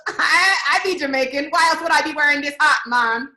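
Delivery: mock Jamaican patois, laughing through it; fake Jamaican accent. Chappelle's character convincing Samson he is Jamaican, fake Jamaican accent. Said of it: "trying to convince Samson that he's Jamaican".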